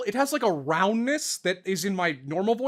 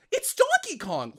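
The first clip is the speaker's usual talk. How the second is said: a lightly pitched-up retold warm-up line; hint of the higher hero voice; Pit's voice; 10 semitones higher than usual. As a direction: slip back into the boyish voice mid-take. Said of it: "It's just like".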